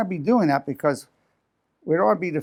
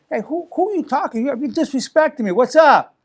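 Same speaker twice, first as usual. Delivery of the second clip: much higher, building to a loud confrontational challenge; combative; 10 semitones higher, 4 dB louder than usual. Retold or imitated. Confronting someone who talks down to you, combative. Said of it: "sets up that combative situation".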